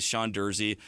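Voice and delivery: ordinary talk, nothing marked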